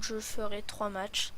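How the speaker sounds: usual speaking voice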